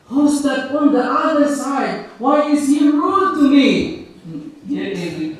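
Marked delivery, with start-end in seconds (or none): 0.1-4.0 high, loud plea to daddy; raised, protesting child's question; upset little boy. A narrator's reading. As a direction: ask like a child complaining to his father. Boy asks father, hurt and puzzled.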